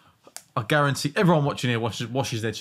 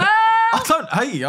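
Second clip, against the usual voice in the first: laughing; 6 dB louder, slower than usual. Real, not acted. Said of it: "laughing reply".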